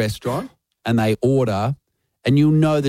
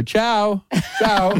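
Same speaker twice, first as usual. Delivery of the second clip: breezy farewell; 9 semitones higher than usual. Acted. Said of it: "you go".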